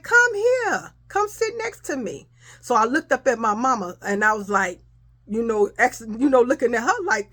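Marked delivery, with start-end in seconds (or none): 0.0-2.3 dad calls her again and insists she sit by him; dad insisting. Retold or imitated. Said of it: "My dad was like".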